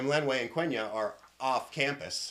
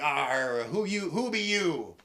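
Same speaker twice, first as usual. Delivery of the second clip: gruff "arr" challenge, rising in pitch; hammed-up pirate interrogation; pirate captain; 3 semitones higher, 5 dB louder, slower than usual. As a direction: demand a name like a pirate captain. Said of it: "And the captain's like".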